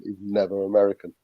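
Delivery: ordinary delivery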